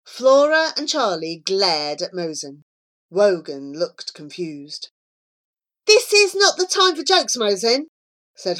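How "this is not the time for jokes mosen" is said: high-pitched, louder telling-off; exasperated girl character; scolding. Acted. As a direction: reprove the joker firmly. Flora scolds the joker.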